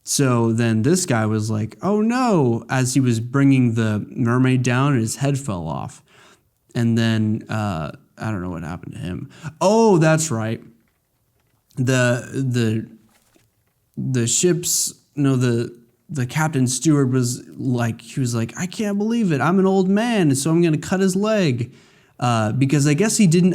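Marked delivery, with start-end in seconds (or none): 1.8-2.6 high, hammed-up oh no; lightly mock dismay; mock alarm. Voicing a doomed cook. 18.6-21.7 high put-on grumble; lightly hammed-up indignant elder; mock old man. Voicing the old steward.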